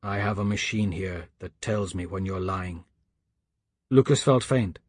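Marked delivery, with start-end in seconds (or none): 0.0-2.8 low, soft, knowing voice; grave caller. A narrator's reading. Caller tells Lucas he detects lies, low and grave. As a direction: quietly call out the lie.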